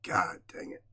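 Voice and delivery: near-whispered curse through tears; barely voiced, overcome; choked up. Real, not acted. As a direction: let it out very softly, fighting real tears. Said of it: "why I'm getting choked up".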